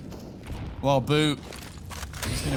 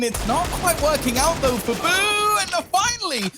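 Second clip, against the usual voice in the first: building excitement; 5 semitones higher than usual. Real, not acted. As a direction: build from steady to frantic. Live play-by-play rising.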